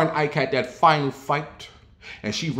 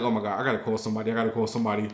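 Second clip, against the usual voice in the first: panicked rush; 3 semitones lower, 5 dB quieter, much faster than usual. Retold or imitated. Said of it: "I'm like".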